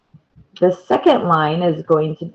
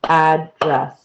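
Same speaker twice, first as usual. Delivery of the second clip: "address" in two beats, AD, dress; clapped-out syllables. Shown as a demonstration. Teacher clapping out "address", two separated syllables. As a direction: say "address" syllable by syllable, AD first and stronger. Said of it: "You can clap it out".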